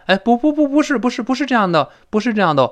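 high, hurried string of denials; acted protest. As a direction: wave it off in a high, insistent voice. Acting out the dialogue's denial.

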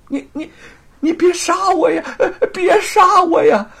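very high, loud, wailing plea; panicked pleading. A narrator's reading. Thief begging the swordswoman, panicked pleading. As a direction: cry out begging for your life. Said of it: "何莲害怕了".

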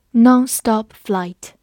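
slow, loud teacher model; slow model reading. Shown as a demonstration. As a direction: say it slowly for learners to copy. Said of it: "teacher models the phrase".

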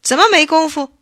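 loud, high, urgent question. A narrator's reading. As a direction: demand an answer, voice jumping up. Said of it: "头人更急了".

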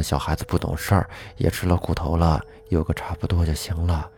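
soft, low mutter to herself; muttering under breath. Retold or imitated. The aunt murmurs a plea while massaging the girl's leg, softly. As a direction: murmur it half to yourself. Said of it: "小声的嘀咕着".

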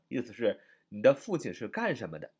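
ordinary talk

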